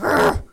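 short mock growl; playful "rrr" growl; imitated growl. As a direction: growl like someone fiercely focused. Imitates intense headphone-wearing gym girls.

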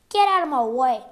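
loud, forceful order; angry command. A narrator's reading. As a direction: shove past with the voice. Burly visitor pushes past the boy.

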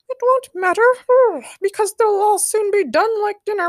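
very high, loud creepy critter; strange voice. A narrator's reading. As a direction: squeak out a sinister threat. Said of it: "answered another strange voice".

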